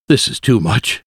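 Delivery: usual speaking voice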